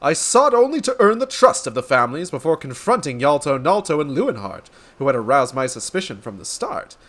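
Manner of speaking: grandiose detective declaiming to an audience; theatrical, florid gentleman sleuth; pompous inspector. Acted. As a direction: proclaim it like a self-important hero. Inspector explains his plan.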